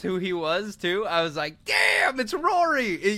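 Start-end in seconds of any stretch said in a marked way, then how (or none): 1.7-2.9 high-pitched amazed outburst; excited recognition. Retold or imitated. Fan retelling spotting a familiar actor, high excited surprise. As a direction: shoot the voice way up in delight.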